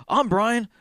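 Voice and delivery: lightly voiced high "I'm Brian" chorus; each claims to be Brian; crowd of mock claimants. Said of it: "He's like".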